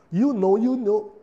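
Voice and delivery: teasing imitation of a cocky kid; mocking know-it-all. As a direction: drag it out like a smug teen. Mimicking a know-it-all teenager.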